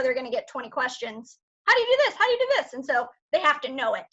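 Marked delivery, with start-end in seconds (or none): 1.7-2.1 put-on high, eager kid's question rising; kids asking. Teachers voicing kids' repeated questions. 2.1-2.7 put-on high kid's question falling at end; kids asking again. Teachers voicing kids' repeated questions.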